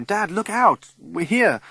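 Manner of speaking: high, loud panicked scream; child screams at swerving Dad. Acted. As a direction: shriek the warning. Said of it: "and I scream".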